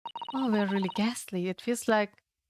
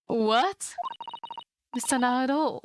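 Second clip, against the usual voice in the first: normal talking voice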